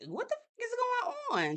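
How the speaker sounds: high, soft, stunned squeak; Stormy can't believe it; baffled Stormy. Retold or imitated. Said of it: "Stormy was like".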